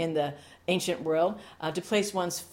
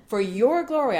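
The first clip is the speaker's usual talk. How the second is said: loud, higher cry of praise; calling out; 6 semitones higher, 8 dB louder than usual. Acted. Pastor crying out in prayer, loud and high. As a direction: lift it up to God.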